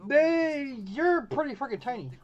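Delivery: ordinary delivery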